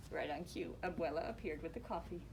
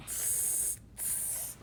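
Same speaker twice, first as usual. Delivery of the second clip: lizard spit; 6 dB quieter than usual. Acted. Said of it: "he spat".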